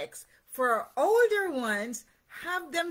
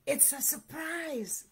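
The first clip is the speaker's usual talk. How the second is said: soft, higher hush; whispered; 4 semitones higher, 6 dB quieter than usual. A narrator's reading. Squirrel sharing the surprise with Duck, softly whispered. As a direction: share a secret softly. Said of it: "whispered Squirrel".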